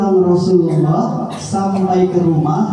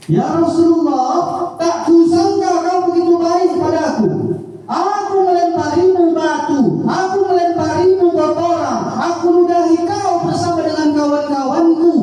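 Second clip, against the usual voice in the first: grateful Quraysh enemy; 12 semitones higher than usual. Acted. Quraysh man thanking the Prophet.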